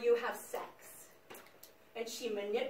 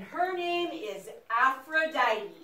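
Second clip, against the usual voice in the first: slow, drawn-out naming of the goddess; grand announcement of Aphrodite's name; slow grand reveal; slower than usual. Acted. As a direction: unveil her name slowly, with weight.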